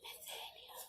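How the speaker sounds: put-on whispering the child's name. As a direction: hush the name like the wind. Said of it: "It's whispering your name again".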